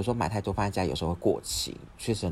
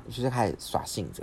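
normal talking voice

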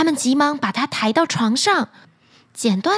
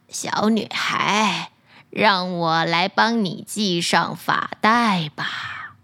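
put-on old crone voice; 6 semitones lower, slower than usual. Old woman offers help.

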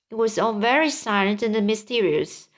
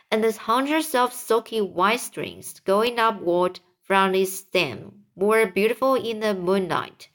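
ordinary delivery

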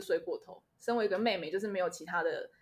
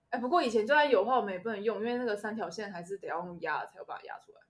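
usual speaking voice

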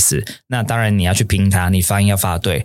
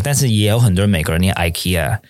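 usual speaking voice

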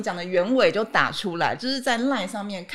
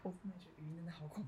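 whispering; 3 semitones lower, 26 dB quieter, much faster than usual. Real, not acted. Dreading voice messages.

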